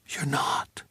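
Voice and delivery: stunned hushed whisper. Acted. Sadie whispers to George in disbelief, whispering. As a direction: breathe it out, barely voiced. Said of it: "she whispered".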